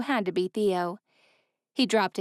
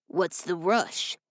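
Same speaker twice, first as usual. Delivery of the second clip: raspy thug; 2 semitones lower, slower than usual. A narrator's reading. Raspy thug stops Ella.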